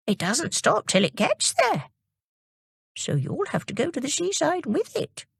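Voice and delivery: high, kindly grandmotherly voice, hushed in places; kindly old lady. Acted. Old lady comforts Jimmy and invites him to the sands, kindly. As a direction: promise him a treat at the seaside. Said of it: "she said".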